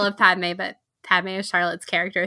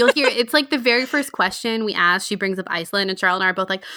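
ordinary talk, nothing marked

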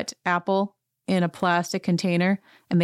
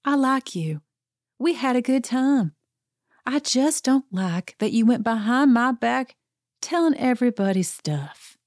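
higher, wounded, mock-gentle reproach fading to a whisper; pitched-up, hurt confrontation that trails off into a whisper; Sutton impression; 5 semitones higher than usual. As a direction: play her as a sweet but hurt southern lady. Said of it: "and she says".

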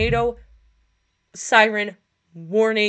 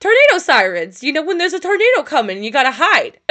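mock loud explaining; 6 semitones higher, 7 dB louder than usual. Retold explaining to coworkers.

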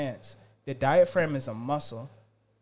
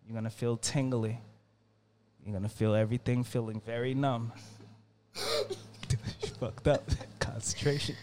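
ordinary delivery

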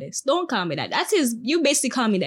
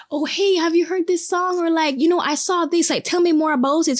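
higher-pitched, keenly curious imitation; appreciative bartender; 7 semitones higher than usual. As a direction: ask like an eager fan wanting to learn. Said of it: "very appreciative".

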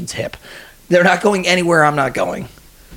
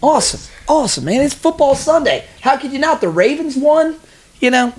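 upbeat excited; 9 semitones higher than usual. Real, not acted. Cheering up a kid guest.

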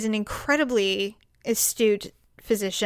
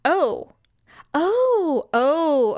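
flustered oh; 5 semitones higher, 4 dB louder, much slower than usual. Retold or imitated. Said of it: "So I said".

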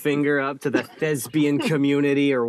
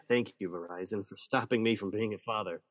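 quiet sarcastic thanks; 6 semitones lower, 9 dB quieter than usual. Acted. Sarcastic aside, much softer.

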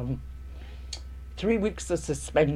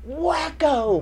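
smack sound; 11 semitones higher, 4 dB louder than usual. Retold or imitated. Mother hits her, sound effect.

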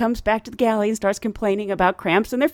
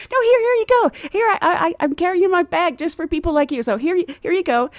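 higher, louder, eager hand-over; a higher, louder eager here you go; eager offering; 7 semitones higher, 4 dB louder than usual. Retold or imitated. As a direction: push the gift on her excitedly. Eagerly handing over soap.

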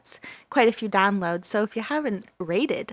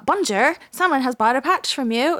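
higher, louder chirpy alert voice with a bonjour; mock notification voice; 4 semitones higher, 3 dB louder than usual. Host imitating the shop notification, mock cheery. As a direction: play the notification as a cheery announcer. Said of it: "It doesn't say bonjour".

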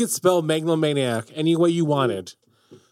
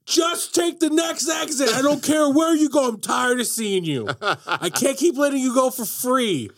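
fed-up toll booth shout; 10 semitones higher than usual. Retold or imitated. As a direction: yell it like a sick-of-you toll collector. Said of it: "the guy working the toll booth just goes".

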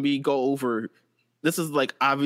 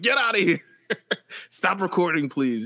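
shooing; 6 semitones higher than usual. Retold or imitated. Referee shooing old lady.

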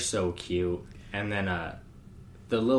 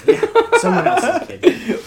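laughing; 11 dB louder, slower than usual. Real, not acted. Joking about not wanting kids.

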